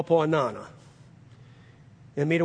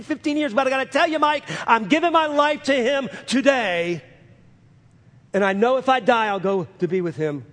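raised, ringing pulpit voice; preaching at full force; 6 semitones higher, 3 dB louder than usual. Acted. Preacher relaying a dying man's confession, full-force preaching. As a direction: proclaim it high and loud to the congregation. Preacher retells Eddie's words.

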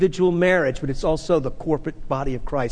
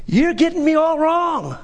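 emphatic correction; 10 semitones higher, 4 dB louder than usual. Real, not acted.

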